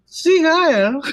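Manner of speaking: high, rising parody salute; mock German salute. Teasing a co-host after the Nazi jibe, high mock salute. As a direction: call it out in comic German.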